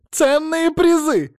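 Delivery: very high, loud, drawn-out cry; shouted unison echo. A narrator's reading. Mother-in-law echoes the TV promise, high slow mocking shout. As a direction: chant it slowly at the top of the voice. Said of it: "они с тёщей произнесли в унисон".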